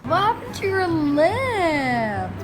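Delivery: talking to the cow. Real, not acted. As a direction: tease the cow like a friend.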